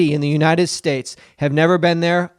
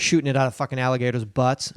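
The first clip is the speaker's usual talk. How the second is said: normal talking voice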